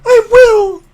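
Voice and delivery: very high, loud cry of I will; shouted oath. Acted. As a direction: fling out the vow at full voice. Young queen swears her oath.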